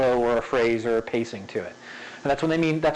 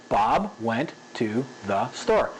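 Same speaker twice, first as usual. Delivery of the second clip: slow, low, word by word; robotic, each word given equal time; evenly spaced words; 4 semitones lower, slower than usual. Shown as a demonstration. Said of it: "that's a very robotic way of saying it".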